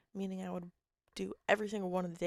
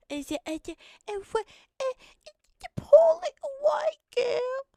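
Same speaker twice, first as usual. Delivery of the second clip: mock whiny girl; 11 dB louder than usual. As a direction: wail like a helpless little girl. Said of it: "Sorry, that was a twitch".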